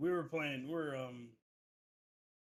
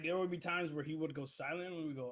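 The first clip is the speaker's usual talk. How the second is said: usual speaking voice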